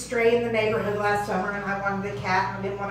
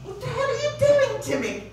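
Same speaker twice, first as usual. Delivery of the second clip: high yowling cat complaint; mock cat protest, much higher; 11 semitones higher than usual. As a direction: whine it like a cat being tormented. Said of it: "you get certain sensation like".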